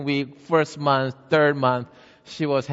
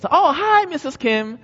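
high, bright-toned greeting, much higher; cheerful neighbor; 5 dB louder than usual. Acted. Neighbor Missus Smith greeting Missus Kim at the door, high and cheery. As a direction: sing out a friendly hello at the door.

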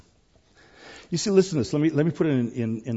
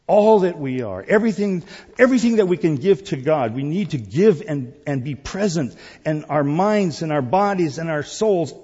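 raised, louder preacher's voice; full-force preaching; 5 semitones higher, 7 dB louder than usual. Real, not acted. Preacher urging the congregation to truly worship, full-force preaching. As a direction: drive each phrase home high and loud.

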